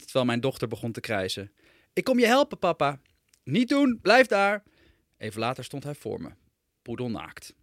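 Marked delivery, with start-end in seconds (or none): 1.9-3.0 put-on high, loud eager child's shout; a toddler yelling helpfully up the stairs; little boy calling. Son shouting to his father that he is coming, high eager yell. 3.4-4.6 much higher and louder, urgent command; frantic shout. Acted. Father shouting at his son to stay put, panicked yell.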